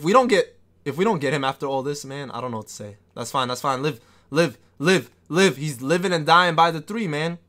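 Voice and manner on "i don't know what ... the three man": ordinary talk, nothing marked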